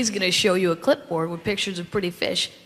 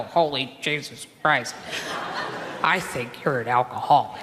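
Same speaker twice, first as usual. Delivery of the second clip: low, quiet mock horror at himself; stunned self-talk; 9 semitones lower, 4 dB quieter than usual. Talking to himself over the bill, low and quietly appalled. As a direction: mutter it to yourself in low dismay. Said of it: "I go".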